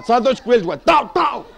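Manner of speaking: normal talking voice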